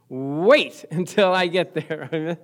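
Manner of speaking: slow, stretched-out delivery; slow, drawn-out demonstration of waiting. Shown as a demonstration. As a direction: drag the words out to show waiting. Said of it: "What does wait mean?".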